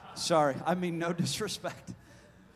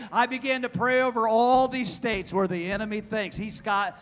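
raised pitch, chant-like repetition growing louder; building preacher cadence; 6 semitones higher, 6 dB louder than usual. Real, not acted. Preacher recounting prayers over the states, building cadence. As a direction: roll through the list on one high note, building.

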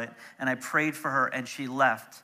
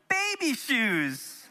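mock deadpan; 7 semitones higher, much slower than usual. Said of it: "we could have stood up in that meeting and been like".

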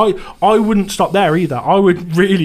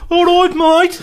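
put-on Brummie accent; 13 semitones higher, 4 dB louder than usual. Teasing co-host about his supposed accent, Brummie. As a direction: exaggerate a Dudley Brummie accent, pitched right up. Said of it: "This is what John thinks I am".

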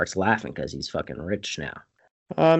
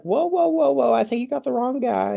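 alarmed denial. Retold or imitated. Acts out his alarmed denial.

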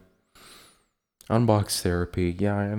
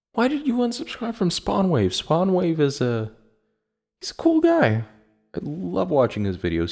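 squeaky high indignant fan voice, much higher; outraged fan; 10 semitones higher than usual. Retold or imitated. A fan scolding him for unsubscribing, squeaky and high. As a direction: play a shocked superfan defending his favorite.

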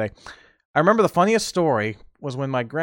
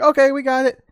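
cheerful agreeing; 11 semitones higher, 6 dB louder than usual. Retold or imitated. Family agreeing.

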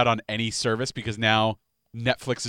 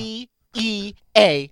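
high, slow letter by letter; exaggerated patience, each letter stretched; mock spelling out; 9 semitones higher, 4 dB louder, slower than usual. Co-host spelling the slang for him, mockingly slow.

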